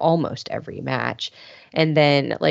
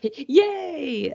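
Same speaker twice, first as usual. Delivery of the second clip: high, drawn-out fake yay; faked happy cheer; 11 semitones higher than usual. [name] trying to look happy for the win, faked cheer. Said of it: "try to pretend to look happy".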